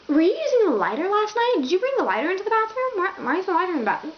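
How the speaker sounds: high, quick string of questions; puzzled questioning. Retold or imitated. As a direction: imitate an urgent, baffled interrogation. Retells quizzing Lance about the lighter.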